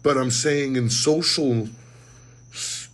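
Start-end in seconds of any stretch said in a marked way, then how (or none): none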